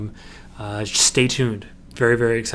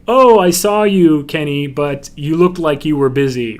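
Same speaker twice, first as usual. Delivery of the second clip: imitated fan's excuse; 3 semitones higher, 7 dB louder than usual. Animator mimicking a shy fan's Facebook post, hammed-up excuse.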